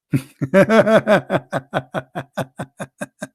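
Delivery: drawn-out derisive laughing fit; mocking laugh. Real, not acted. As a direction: laugh at length at a silly argument.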